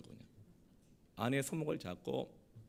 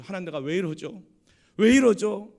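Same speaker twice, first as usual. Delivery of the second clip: anguished plea building to a shout; retold tearful lament; 6 semitones higher, 13 dB louder than usual. Ashamed husband laments before God, acted anguished cry. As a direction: act out a desperate complaint, growing much louder. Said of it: "막 울면서 하나님 앞에".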